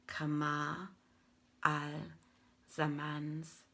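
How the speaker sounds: ordinary delivery, nothing marked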